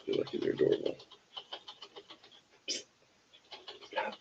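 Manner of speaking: hushed muttering to the pets; soft whispered aside off-mic. Real, not acted. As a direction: whisper under the breath.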